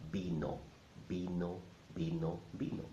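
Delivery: "vino" begun with a soft b sound; b-like first sound on "vino"; Spanish V as B. Shown as a demonstration. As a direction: say "vino" with a b in place of the v. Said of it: "the V sounds like a ba".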